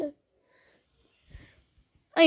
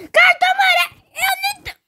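much higher, louder outraged shout; 12 semitones higher, 4 dB louder than usual. Real, not acted. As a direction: cry out at the card in outrage. Gets a yellow card.

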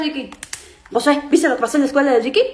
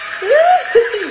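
excited laugh; 9 semitones higher, 4 dB louder than usual. Acted. Celebra el millón de dólares.

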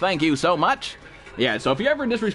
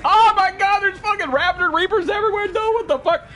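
real startled panic; panicked yelling; 11 semitones higher than usual. Real, not acted. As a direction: shout in shock at the monsters. Raptor Reapers everywhere.

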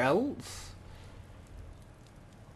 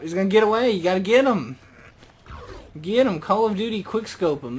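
loud, higher, urgent; excited urging; 4 semitones higher, 6 dB louder, faster than usual. Real, not acted. Gamer urging the kill before the deer escapes, loud excitement. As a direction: push hard before the prey escapes.

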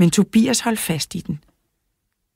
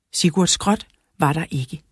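plain reading voice

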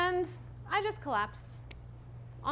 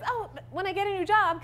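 put-on bright, higher, cheery promise to the kids; upbeat reassurance; 8 semitones higher, 4 dB louder, faster than usual. Mother promising her kids a party, upbeat. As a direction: sell the silver lining with a lift. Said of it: "silver lining ... positivity".

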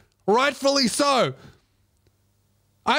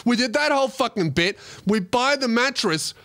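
ordinary talk, nothing marked

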